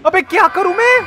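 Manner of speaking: high, loud exasperated plea; loud high helpless cry; frustrated cry. Real, not acted. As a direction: throw the question up in frustration.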